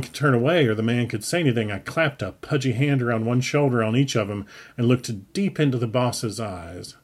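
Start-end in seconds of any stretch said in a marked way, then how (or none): none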